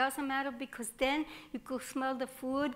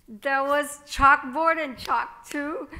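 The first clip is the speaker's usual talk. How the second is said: ordinary talk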